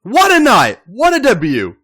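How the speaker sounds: ordinary delivery, nothing marked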